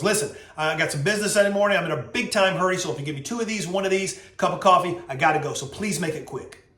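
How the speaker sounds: fast-paced New Yorker in a hurry; New York accent. Retold or imitated. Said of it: "in his very, you know, fast-paced New York accent".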